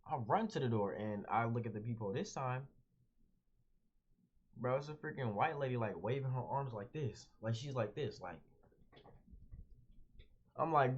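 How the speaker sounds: ordinary talk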